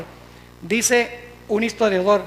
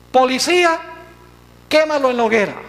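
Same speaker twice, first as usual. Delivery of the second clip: mock summons, higher and louder at first; church giving orders; 3 semitones higher, 4 dB louder than usual. Church sending police after those who disobey, mildly commanding. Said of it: "la iglesia decía".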